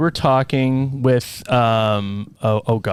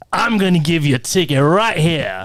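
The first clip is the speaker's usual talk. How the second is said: put-on officious character voice, higher; mimicking John; 5 semitones higher than usual. Scare actor with ticket book threatening a fine, mimicked character voice. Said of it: "I'm trying to mimic his voice".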